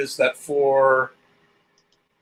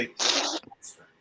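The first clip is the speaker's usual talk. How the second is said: quiet breathy whisper; 11 dB quieter, slower than usual. Shown as a demonstration. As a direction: hiss it under your breath. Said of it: "I will say".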